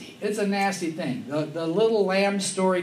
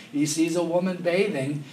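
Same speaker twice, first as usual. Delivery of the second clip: usual speaking voice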